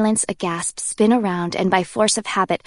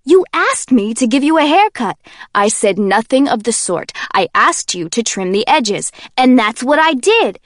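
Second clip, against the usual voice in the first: loud, higher indignant protest; defensive Kenji; 4 semitones higher, 7 dB louder than usual. Acted. Kenji defends the haircut to Warner, loud and indignant. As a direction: argue back hard.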